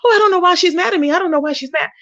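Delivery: mocking the channel owner who plays dumb, fast and high-pitched; mock innocence. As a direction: parody her playing dumb, quick and high. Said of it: "you try to pretend like you don't know".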